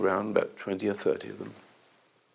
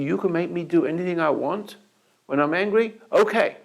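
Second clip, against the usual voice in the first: rising, louder and higher into anger; getting angry; 7 semitones higher, 4 dB louder than usual. Retold or imitated. A lanky guy rising to the bait, growing angry. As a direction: let the anger build. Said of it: "He goes angry".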